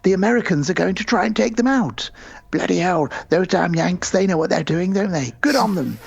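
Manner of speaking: a hammed-up eager Brit British accent, voice pushed up; mock British accent. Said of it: "particularly the British ... they go".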